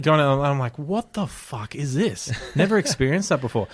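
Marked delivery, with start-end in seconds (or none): 0.8-2.3 slow, higher, softer disbelief; retold bewilderment. Passenger in a speeding honking taxi, retold with bewildered disbelief. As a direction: draw it out in mock dismay.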